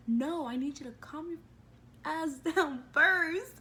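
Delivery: indignant comeback breaking into a laugh; exasperated retort. Retold or imitated. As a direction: build in force, drawing out the end. Retold angry retort, rising to laugh.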